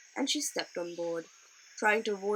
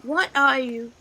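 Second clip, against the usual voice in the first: loud, higher challenge; sharp Leela; 5 semitones higher, 11 dB louder than usual. A narrator's reading. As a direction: demand an answer. Leela confronts the rowers.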